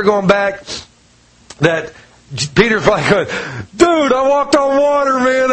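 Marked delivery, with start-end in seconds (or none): 3.8-5.5 higher, buzzing with mock glee; lightly voiced excited Peter; excited bragging. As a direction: brag like a thrilled kid.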